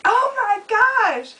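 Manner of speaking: high, slow mock gasp of shock; drawn-out startled oh my gosh; retold shock. Seeing a dinosaur in her front yard, a shocked gasp. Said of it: "So I was like".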